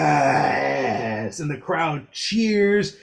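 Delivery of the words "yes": triumphant yes. Acted. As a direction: throw your arms up and shout it. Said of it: "stands up and goes".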